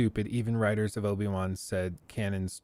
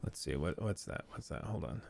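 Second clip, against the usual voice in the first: ordinary talk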